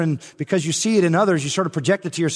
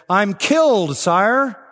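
raised, higher voice of a wounded soldier announcing his death; proud soldier's cry; 6 semitones higher, 5 dB louder, much slower than usual. Acted. Wounded soldier to Napoleon, a proud raised cry.

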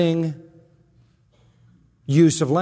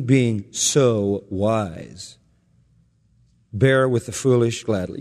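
slow sarcastic; 6 semitones lower, slower than usual. Retold or imitated. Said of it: "And he says this".

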